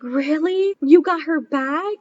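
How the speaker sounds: excited disbelief. Retold or imitated. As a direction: gasp it out in happy disbelief. Kid discovers returned dog.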